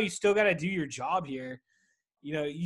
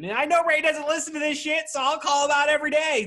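calling out; 11 semitones higher, 9 dB louder than usual. Real, not acted. Calling out Ray.

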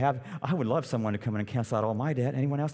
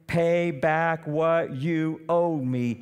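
slow, pressing demand; 4 semitones higher, 4 dB louder, much slower than usual. Acted. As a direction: lean on each word of "Pay back what you owe me". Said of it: "he demanded".

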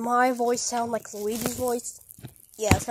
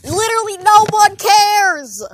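high, loud, fed-up yell; exasperated shout; 7 semitones higher, 10 dB louder than usual. Acted. Friend cuts off Koopa's endless name repeating, exasperated shout. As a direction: yell it to shut them up.

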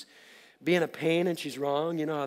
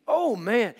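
ordinary delivery

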